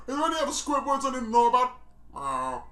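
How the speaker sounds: normal talking voice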